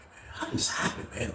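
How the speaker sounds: voiceless whisper of disbelief; shocked whisper. Retold or imitated. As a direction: whisper it to yourself, stunned. Shocked at night in his cell.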